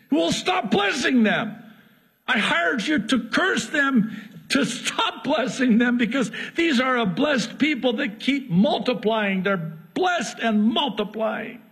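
a boss ordering his hireling to stop; angry Balak. Acted.

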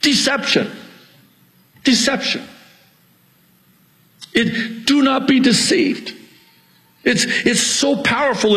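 very high, urgent pulpit cry; impassioned high-pitched preaching yell; real preacher's yelling. Real, not acted. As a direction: hammer the warning out at high pitch. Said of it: "I'm sorry, I'm yelling".

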